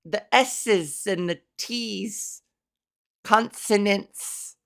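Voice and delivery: "S's", "T's" and "consonants" slow, with crisp sibilants; slow, careful hissed S's and crisp T's on "S's", "T's", "consonants"; over-enunciated consonants. Shown as a demonstration. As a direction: draw out "consonants", sounding each S and T sharply. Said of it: "I don't, I don't do that".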